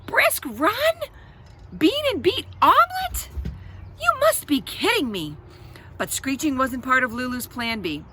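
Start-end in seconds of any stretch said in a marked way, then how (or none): 0.0-5.4 high, loud outraged screech. A narrator's reading. Lulu screeches at babysitter in a tantrum. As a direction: shriek it like a tantrum. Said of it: "She would screech".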